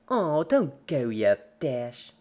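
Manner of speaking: loud, slow, mock-pleading; creepy cheerful Pinkie. A narrator's reading. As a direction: beg the fading friend to stay.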